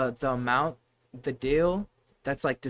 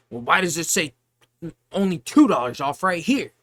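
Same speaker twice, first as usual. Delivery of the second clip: louder mock indignant customer voice; annoyed customer; 2 semitones higher, 7 dB louder than usual. Cashier mimicking a customer arguing over the discount, louder and indignant. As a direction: play the customer demanding answers. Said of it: "He's like".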